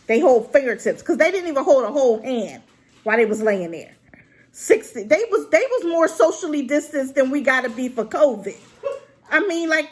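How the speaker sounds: usual speaking voice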